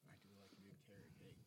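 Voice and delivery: soft breathy whisper. Real, not acted. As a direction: whisper it close to the mic. Whispered aside on cake.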